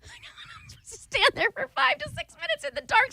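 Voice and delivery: high, laughing outburst of exasperation; laughing disbelief. Real, not acted. Exasperated at being told to wait.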